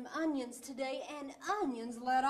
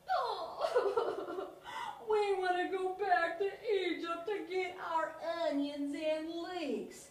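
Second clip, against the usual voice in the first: hammed-up blubbering whine, a bit higher, broken by a laugh; boo-hoo like a big crybaby; mock crying; 5 semitones higher, slower than usual. Israelites crying to go back to Egypt, hammed-up blubbering. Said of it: "they even started to cry".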